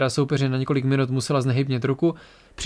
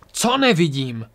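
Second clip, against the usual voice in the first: raised voice, pitch leaping then falling; shouted question; slower than usual. A narrator's reading. Bastien to the man saying he doesn't see, shouting. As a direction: cry it out in frustration. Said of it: "křikl Bastien".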